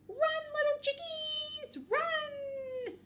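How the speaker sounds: very high, drawn-out cartoon cry; slow, high falsetto holler; calling out. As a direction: stretch the words in a high squeal, urging them on. Piranha urges chickens to flee.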